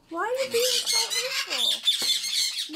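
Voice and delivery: high, slow, drawn-out reproach; long-drawn, high-pitched dismay; exasperated scolding. Real, not acted. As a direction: scold a pet, stretching out the words. Scolding birds for fighting.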